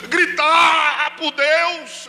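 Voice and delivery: high, drawn-out yell; shouting out to God. Shown as a demonstration. Preacher saying he needed to cry to God, high long shout. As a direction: let the word burst into a long shout.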